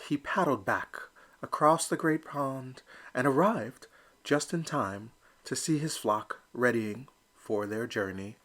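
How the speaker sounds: usual speaking voice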